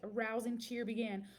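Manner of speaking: ordinary delivery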